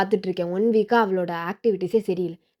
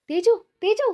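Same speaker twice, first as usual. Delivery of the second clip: high-pitched urgent call; calling after someone; 12 semitones higher, 3 dB louder than usual. Acted. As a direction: call your daughter back, pitch shooting up. Mother calls after daughter storming off. (உயர்ந்த குரலில் மகளைக் கூப்பிடுவது)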